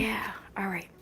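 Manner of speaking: whispered aside; soft whisper. Real, not acted. Mom whispering back to her son mid-story. Mom answering child softly.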